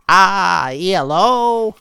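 plain reading voice

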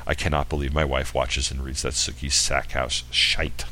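a whispered aside; whispering. Real, not acted. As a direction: whisper it as a secret gripe. Aside about his wife's taste.

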